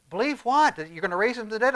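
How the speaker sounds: high, pointed questioning; mock incredulity. Preacher voices a doubter answering God, incredulous. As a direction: throw it back in acted disbelief.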